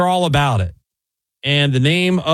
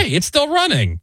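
put-on drunk guy's line; 12 semitones higher than usual. Drunk guy spotting the running police cruiser, high mock voice. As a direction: squeak it out like an amazed drunk. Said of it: "and he's like".

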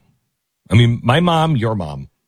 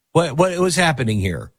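ordinary talk

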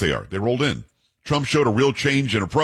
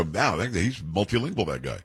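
mock awe, fading softer and lower; imitating impressed onlookers; 4 semitones lower, 6 dB quieter than usual. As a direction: onlookers marvel at host's French, hushed mock awe. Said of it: "People right there go".

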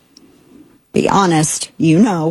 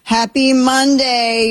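high, slow sing-out; drawn-out greeting; 7 semitones higher, slower than usual. Real, not acted. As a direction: stretch the greeting out long and high. Drawn-out show greeting, then protested.

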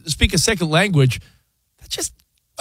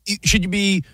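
ordinary talk

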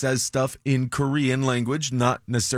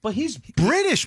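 incredulous; 11 semitones higher, slower than usual. Real, not acted. Incredulous objection, much higher.

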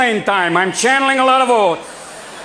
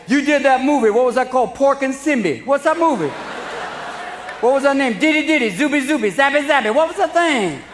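imitating a fan; 5 semitones higher than usual. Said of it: "people come up and say".

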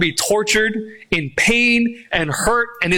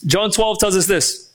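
normal talking voice

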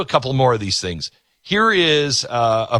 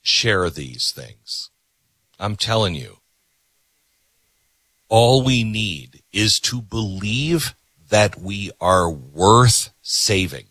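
sincere appeal; 6 semitones lower, slower than usual. Real, not acted. Closing heartfelt appeal.

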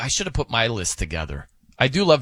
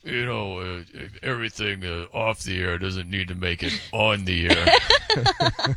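Coop impression; 7 semitones lower than usual. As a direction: draw it out slowly, playing Coop. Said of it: "let me do my Coop impression".

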